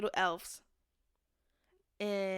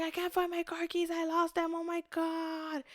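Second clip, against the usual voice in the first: high-pitched mock fretting; retold panic; 8 semitones higher than usual. Woman retelling losing her car keys, mock panic. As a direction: wail lightly, drawing out the last words.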